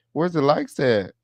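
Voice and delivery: normal talking voice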